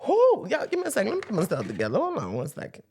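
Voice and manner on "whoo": very high loud whooping shout; full church whoo. Real, not acted.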